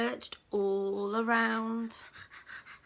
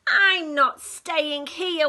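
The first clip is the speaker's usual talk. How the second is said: wailing; 2 semitones higher, 12 dB louder, slower than usual. Acted. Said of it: "wailed the duck".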